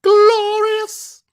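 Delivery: high, loud, stretched-out cry; belted catchphrase. Acted. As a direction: belt it like a wrestling entrance theme. Mimicking wrestler's sung theme word.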